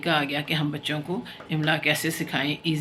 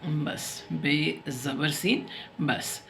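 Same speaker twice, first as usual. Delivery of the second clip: slow spelling aloud; 6 dB quieter, much slower than usual. Shown as a demonstration. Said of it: "spelling out letter by letter".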